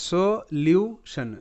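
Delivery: সলিউশন very slowly as "so... lyu... shan"; careful syllable-by-syllable model. Shown as a demonstration. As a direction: break সলিউশন into three separated syllables, with a "lyu" glide. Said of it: "উচ্চারণটা ভালো করে শোনো".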